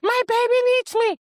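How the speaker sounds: high, loud hysterical mother; acted frantic scream, pitch far up; flipping out. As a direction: wail it in full panic, acting. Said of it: "Now the woman is flipping out".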